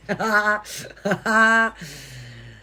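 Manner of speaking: loud open laughter in long bursts. Real, not acted. Laughs after parodying the motto.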